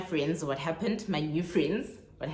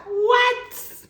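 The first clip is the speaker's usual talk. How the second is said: high, loud shriek of disbelief; shocked what; 11 dB louder than usual. Real, not acted. Hearing that the strangers are South African, shrieked in shock. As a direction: squeal it in total shock.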